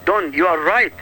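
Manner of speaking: normal talking voice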